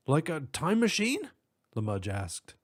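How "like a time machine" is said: high cop voice. Acted. Cop asks.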